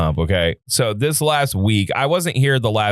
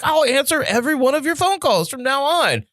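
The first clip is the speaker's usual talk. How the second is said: much higher doting wife; man doing a woman's voice; 12 semitones higher than usual. Retold or imitated. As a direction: gush the promise. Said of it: "And she's like".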